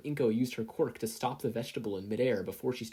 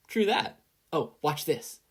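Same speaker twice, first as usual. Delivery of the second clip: lightly voiced, playful hero; a hint of a perky tomboy; Kathleen's voice; 5 semitones higher, 4 dB louder, slower than usual. A narrator's reading. Narrator voicing Kathleen showing off to Inko, lightly raised.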